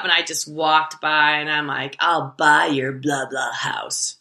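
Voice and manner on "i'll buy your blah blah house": mock snarl. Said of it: "I was just so pissed at her".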